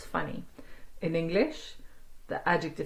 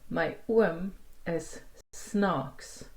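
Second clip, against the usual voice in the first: slow model sentence; much slower than usual. Shown as a demonstration. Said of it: "snaakse oom".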